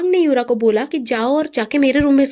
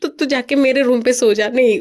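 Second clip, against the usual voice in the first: plain reading voice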